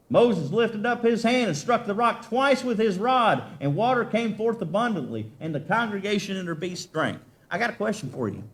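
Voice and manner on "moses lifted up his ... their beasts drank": raised, fervent scripture reading; preaching scripture. Acted. Preacher reading the story of the rock to the church, fervent. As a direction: build the story up for the people.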